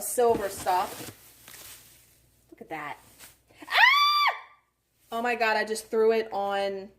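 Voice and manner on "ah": sudden high scream of delight. Real, not acted. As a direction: shriek in excitement. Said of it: "Did not mean to scream".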